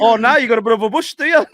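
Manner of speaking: high, loud teasing laugh. Real, not acted. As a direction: tease him, cracking up.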